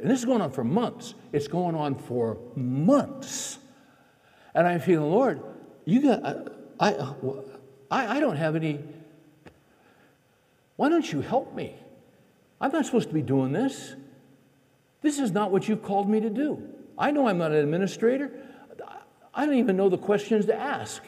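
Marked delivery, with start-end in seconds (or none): 1.3-3.6 stretched very slow, dragging MONTHS; drawn-out emphasis. Retold or imitated. Same words stretched out for emphasis. 5.1-21.0 high-pitched, exasperated prayer in halting bursts; frustrated plea. Retold or imitated. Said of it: "one night I am so frustrated".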